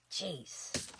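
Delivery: ordinary talk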